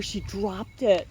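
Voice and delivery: ordinary delivery, nothing marked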